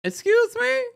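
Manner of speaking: very high, squealing mock cry; imitating crying. Host retelling a castmate's sudden tears, mock crying. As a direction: squeak it out as a put-on wail. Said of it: "Quad starts crying".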